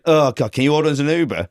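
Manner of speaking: mock-plaintive whinge; whinging mum. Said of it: "she just whinged".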